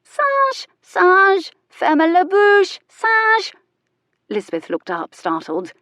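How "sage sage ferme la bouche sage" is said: very high, loud squawking bird voice; shrieking parrot. A narrator's reading. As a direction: screech it like a parrot. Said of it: "the parrot began shrieking".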